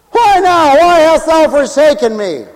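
dramatic pulpit cry of abandonment. Acted. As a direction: demand an answer from heaven. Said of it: "He even cried out from the cross".

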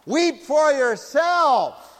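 loud, high, drawn-out command; scolding shout. Acted. Preacher telling off the weepers, scolding shout. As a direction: shout it slowly, hitting each word. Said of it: "That's what I feel like going out and telling".

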